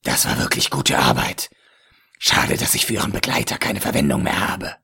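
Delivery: low, leering villain with a chuckle; lecherous villain voice. A narrator's reading. Ägypter, kalt. (tiefe, lüsterne Schurkenstimme)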